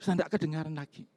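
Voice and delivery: usual speaking voice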